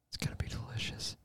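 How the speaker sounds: hushed whisper. Pretend. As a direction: whisper it conspiratorially. Whispered after shout.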